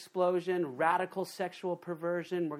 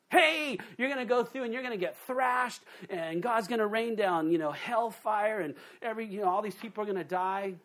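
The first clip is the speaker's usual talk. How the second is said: higher, louder hammed-up bearer of bad news; sarcastic higher-pitched mock warning; mock doom preacher; 5 semitones higher, 3 dB louder than usual. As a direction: lampoon a cheery doom message. Mocking the tribulation-first preaching.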